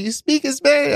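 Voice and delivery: high-pitched excited question. Retold or imitated. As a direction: ask it in eager, high-pitched delight. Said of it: "They get all excited".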